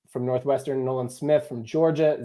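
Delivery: normal talking voice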